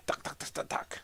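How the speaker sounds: rapid voiceless clicking syllables; imitated mechanical noise. Shown as a demonstration. Builder shows how both whips will go, imitated noise. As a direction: tick out a machine's repeated knocking.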